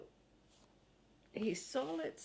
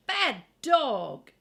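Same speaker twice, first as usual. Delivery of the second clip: a loud, higher "Bad dog!"; scolding shout; 4 semitones higher, 10 dB louder than usual. A narrator's reading. Boy nearly scolds his dog for eating the flower, loud. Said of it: "Henry almost said".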